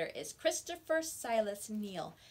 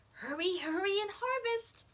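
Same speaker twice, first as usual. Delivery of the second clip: urgent excitement; 9 semitones higher, 4 dB louder than usual. Acted. Racing the cold to harvest.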